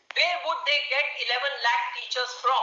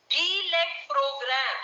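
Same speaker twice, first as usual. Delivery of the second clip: "D led program" slowed right down, each word laid out; stress on D led; much slower than usual. Shown as a demonstration. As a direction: lean hard on "D led" and take your time. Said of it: "I am emphasizing on the word D led program".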